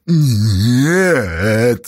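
long, drawn-out howl of denial; wailing. A narrator's reading. Beaten girl denies the charge to Ipat, wailing. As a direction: wail it out, stretching the vowel. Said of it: "завыла девка".

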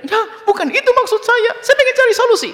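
high, loud, laughing protest; flustered wife. Retold or imitated. Wife protesting she wants a solution, high and loud. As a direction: squeak out the wife's denial. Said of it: "Akan suruh nyerai dia".

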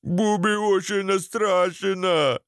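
high, slow wailing character voice; hammed-up crybaby wail; tearful whimpering. A narrator's reading. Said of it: "плакал Буба".

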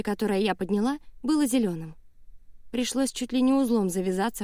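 usual speaking voice

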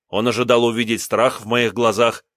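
usual speaking voice

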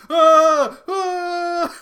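very high yelp of fake fright; high mock scream. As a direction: squeal like a boxer in panic. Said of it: "then it's just George Foreman".

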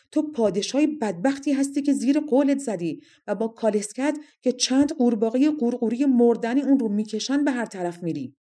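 high, loud offended tirade; angry shout. A narrator's reading. Toritela insulting the king who called her slob, angry shouting. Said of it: "توریتلا با خشم داد زد".

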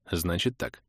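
low, soft, measured start; subdued, deliberate opening; slow, collecting thoughts. A narrator's reading. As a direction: begin slowly, gathering your thoughts. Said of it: "Медленно начал я, собираясь с мыслями".

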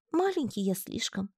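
тихо, со всхлипом, тонко; всхлипни тихим тонким голоском. A narrator's reading. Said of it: "всхлипнул паучок". (паучок всхлипывая признаётся: тихий тонкий голосок)

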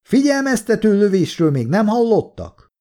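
high and indignant; indignant call. A narrator's reading. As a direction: call out a scornful question. Shouts indignantly toward the trenches.

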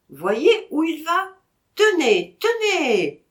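look, there he goes; excited. Acted. Vatrin pointing out the dog. (excité, plus fort et plus aigu)

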